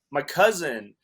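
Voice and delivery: ordinary talk, nothing marked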